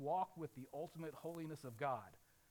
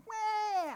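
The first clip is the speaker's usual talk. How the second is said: put-on baby wail; 10 dB louder than usual. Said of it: "that little baby is tired".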